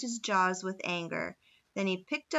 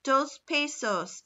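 put-on slow, higher Spanish-accented bid; 6 semitones higher, 4 dB louder, slower than usual. A narrator's reading. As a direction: call the price in a Spanish accent. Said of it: "said a Spaniard".

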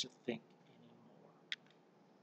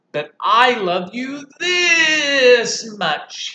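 loud, slow and stretched out; a big hare's grand reply; 11 semitones higher, 13 dB louder, much slower than usual. Acted. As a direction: outdo the little one tenderly. Big hare answers.

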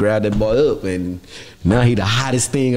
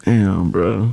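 mock sentimental; 4 semitones lower than usual. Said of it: "Give me that sentimental, damn".

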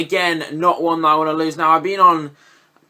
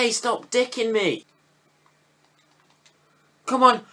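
normal talking voice

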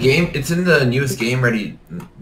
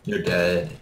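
ordinary talk

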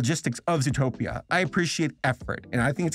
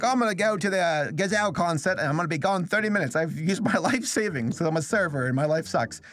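higher-pitched mock Australian girl; imitated Australian accent; 4 semitones higher than usual. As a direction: rattle it off in amateur Australian. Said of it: "girl with an Australian accent".

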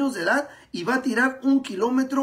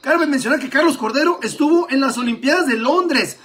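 loud, high sports commentary; live play-by-play; 4 semitones higher, 9 dB louder than usual. Acted. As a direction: call the race live and excited.

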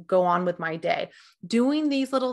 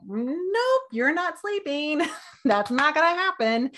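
high, slow mock refusal from the brain; pitched-up playful brain telling you no; stubborn brain voice; 7 semitones higher than usual. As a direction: play your wired brain saying no. Brain personified refusing sleep.

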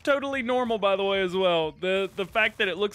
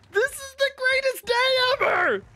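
much higher, louder, laughing delight; ecstatic; 5 dB louder than usual. Real, not acted. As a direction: shriek it with joy. Ecstatic over game moment.

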